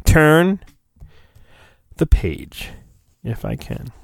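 ordinary talk

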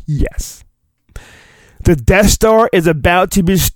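normal talking voice

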